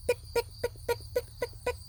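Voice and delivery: very high, fast pick pick; quick high clicks of hurrying heels; running heels. A narrator's reading. Reading to children, high rapid heel clicks. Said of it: "The lady starts to run".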